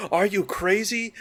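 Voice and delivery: high, mock-alarmed objection; foolish horror victim. Victim urging friends into the barn, mock alarm.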